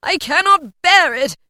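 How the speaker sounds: high, loud, slow anguished cry; desperate shout. A narrator's reading. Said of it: "Scrooge exclaimed".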